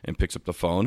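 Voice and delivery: normal talking voice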